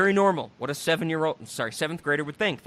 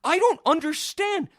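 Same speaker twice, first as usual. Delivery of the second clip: high, incredulous I don't understand; exasperated disbelief; baffled; 11 semitones higher, 4 dB louder than usual. Real, not acted. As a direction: sound truly bewildered. Baffled outburst about the film.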